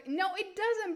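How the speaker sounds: usual speaking voice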